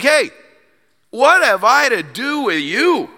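high, drawn-out mock challenge; indignant rebuff. Repeats the prophet's rebuke.

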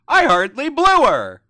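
high, loud protest; hammed-up indignant outburst; mock-indignant shout. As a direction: belt it out in mock outrage. Acts out an outraged chat pun.

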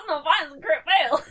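high, loud dismayed cry; excited shout. Real, not acted. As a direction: yell at the bad roll. Reacting to failed roll.

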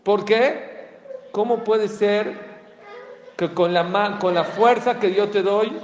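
loud, high, indignant divine voice; a hint of God's indignant reproach; God rebuking. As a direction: thunder down at the sinner like God. Voicing God rebuking the sinner.

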